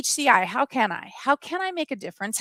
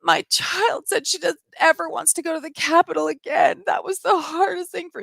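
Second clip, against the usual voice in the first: mock tearful plea; 5 semitones higher than usual. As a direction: imitate him wailing through fake tears. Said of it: "he's crying and saying".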